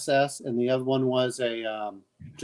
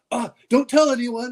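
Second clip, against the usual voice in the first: startled pleading; 9 semitones higher, 4 dB louder than usual. Retold or imitated. Voices soldiers caught playing cards.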